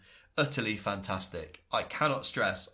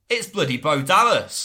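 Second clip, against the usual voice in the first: It's bloody Bo Dallas, louder, in real delight; excited reveal; 6 semitones higher, 10 dB louder than usual. Real, not acted. Podcast host revealing the masked man, excited. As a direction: shout the reveal like a fan.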